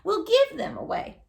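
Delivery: lightly voiced old woman making up her mind; a hint of a brisk old woman. A narrator's reading. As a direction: announce it like a fussy old lady. Old lady decides, voiced.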